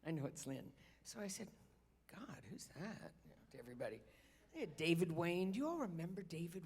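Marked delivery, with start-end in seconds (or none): 2.1-3.1 half-whispered aside, much softer; hushed, mock-innocent question to the room; feigned wondering. Feigns surprise to party guests.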